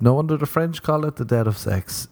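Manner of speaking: usual speaking voice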